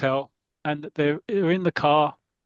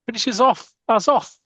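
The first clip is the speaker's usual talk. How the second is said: panicked; 6 semitones higher than usual. Retold or imitated. Panic on runaway coach.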